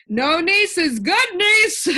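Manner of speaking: high, loud and slow, roaring with glee; roaring laugh. A narrator's reading. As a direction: bellow it out like a gleeful giant. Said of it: "roared the din".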